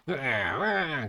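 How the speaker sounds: drawn-out goblin wails; mock creature cry; goblin cries. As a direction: wail like a goblin raising the alarm.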